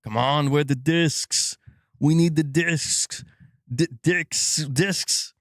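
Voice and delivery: slowed-down mock Arnold Schwarzenegger accent; a rough try at Arnold's Austrian growl; Arnold impression. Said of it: "I'm gonna do his voice".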